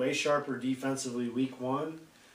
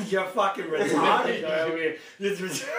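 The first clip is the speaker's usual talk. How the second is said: louder, higher, laughing jab at a friend; loud mock insult; 4 semitones higher, 5 dB louder than usual. Real, not acted. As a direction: bellow the insult across the room in fun. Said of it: "Check out this fucking guy over here".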